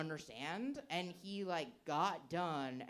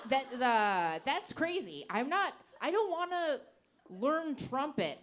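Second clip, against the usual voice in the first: incredulous rant; 6 semitones higher, 5 dB louder than usual. Retold or imitated. Ranting at friend, retold.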